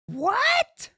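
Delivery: high, exaggerated incredulous squawk; mock shock. Host parodying Phil's reply, over-the-top mock shock. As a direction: blow up the shocked question, pitch leaping up.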